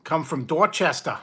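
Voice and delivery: a hint of a Boston accent, dropping the r in Dorchester; mock Boston accent. Vlogger teasing viewers in a mock Boston accent about the chips. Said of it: "You're Boston".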